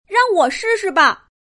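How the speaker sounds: high little-puppy voice; eager volunteering puppy. A narrator's reading. Puppy Doudou offering the chief help, eager and high. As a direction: jump in eagerly, pitched high. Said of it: "从杜宾警长身后跳出来".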